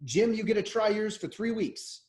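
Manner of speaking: ordinary delivery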